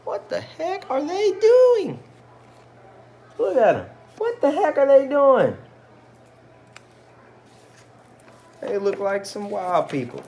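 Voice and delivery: shrieking village gossip baffled by the dancers; gawking neighbor. Acted. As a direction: play a nosy neighbor, high and loud with disbelief.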